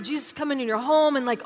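ordinary talk, nothing marked

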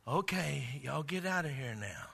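mock a timid request; laughing parody of a meek order; feeble mock voice. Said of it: "voice that was, that".

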